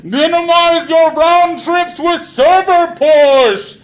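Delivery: loud, high, slow threatening yell; alien yelling. Acted. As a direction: bellow the threat like an alien commander. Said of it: "it starts yelling".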